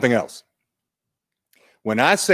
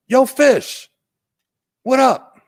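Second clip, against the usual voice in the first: much higher, louder playful fox voice; cool fox greeting; 5 dB louder, slower than usual. Acted. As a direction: call out to the fish like a breezy cartoon fox. Fox greets fish in story.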